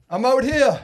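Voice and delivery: loud high-pitched shout to carry; calling back. Retold or imitated. Stranded fisherman answering his friend from the rock, calling back. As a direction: yell back from far out on the rock.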